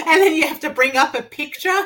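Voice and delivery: high laughing voice. Real, not acted. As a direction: laugh through the words. Laughing at the story.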